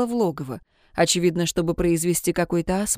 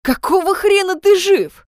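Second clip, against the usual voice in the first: loud, much higher furious yell; angry yell; 11 semitones higher, 7 dB louder than usual. A narrator's reading. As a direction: yell it at him in disbelief. Jun yells at Ludwig.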